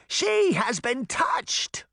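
high, slow, stretched witch scream; screamed accusation. A narrator's reading. Witch pointing directly at Sabrina, screamed. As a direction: screech it out, dragging the words. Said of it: "she screamed".

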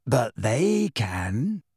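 very slow, dreamy dignity; dignified. A narrator's reading. Luna pointing out the Thestrals, dignified. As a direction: draw it out serenely. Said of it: "in a dignified voice".

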